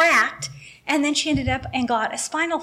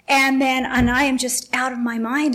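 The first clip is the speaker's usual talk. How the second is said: ordinary talk, nothing marked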